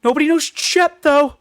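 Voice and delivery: high-pitched name-dropping son of a famous dad; coattail-riding rich son. Acted. Famous actor's son bragging about his family, mock high voice.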